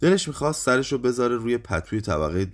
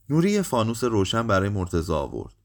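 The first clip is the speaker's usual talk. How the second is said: usual speaking voice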